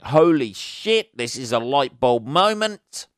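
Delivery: loud, drawn-out burst of sudden realization; mock revelation. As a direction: exclaim it like a stunning epiphany, rising at the end.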